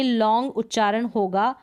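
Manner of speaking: ordinary talk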